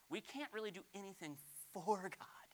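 hushed whisper. Real, not acted. Pastor quietly confiding to the church, whispered. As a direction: confide it under the breath.

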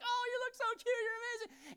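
very high, cooing gush; squeaky-high adoring praise; doting dad. Acted. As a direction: fawn over your little girl. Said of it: "that's what dads do".

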